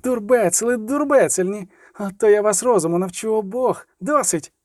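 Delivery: high scolding shout, climbing. Acted. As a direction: yell them down, angrier each line. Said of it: "кричала ще голосніше". (Мільва кричить на бійців, ще голосніше)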